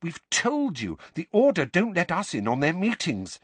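high-pitched, anxious explanation; worried girl justifying herself; nervous. A narrator's reading. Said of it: "said Hermione nervously".

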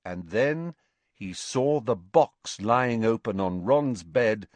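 usual speaking voice

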